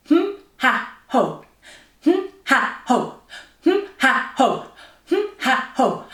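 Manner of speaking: high hum, then punched ha and ho; diaphragm exercise sounds. Shown as a demonstration. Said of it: "get our diaphragm working".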